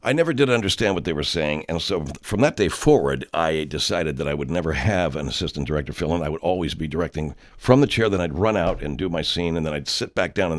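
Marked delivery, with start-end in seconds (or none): none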